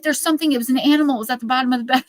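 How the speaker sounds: high, loud and frightened; a retold, shaken mother; terrified mom. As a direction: blurt it out in panic. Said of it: "She's like".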